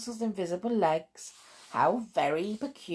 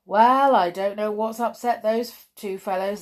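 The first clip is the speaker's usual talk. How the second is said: burly farmer; 3 semitones higher, 8 dB louder than usual. Acted. Burly farmer voiced in story.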